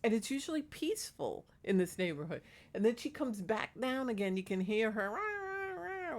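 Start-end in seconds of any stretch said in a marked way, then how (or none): 5.1-6.2 high, harsh wailing rah; mimicking a homeless woman's croak; horrible old lady voice. Neighbor mimics the homeless lady at night, harsh high wail. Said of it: "with that horrible voice".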